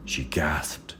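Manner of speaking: breathless whispered gasp. Acted. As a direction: hush the words like a shock.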